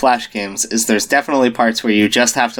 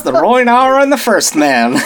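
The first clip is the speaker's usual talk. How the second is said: put-on Buster Scruggs Southern accent; 9 semitones higher than usual. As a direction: proclaim the king's titles like a Southern cowboy. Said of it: "a Buster Scruggs Southern accent".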